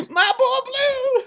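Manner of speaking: very high, slow squeaky imitation; high-pitched cracking voice. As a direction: stretch it out in a cracking falsetto. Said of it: "this really high-pitched voice where it kinda cracks".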